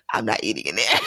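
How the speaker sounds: dropped low for a man's reply; imitated refusal. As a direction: mimic a guy refusing, way down in pitch. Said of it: "And he was like".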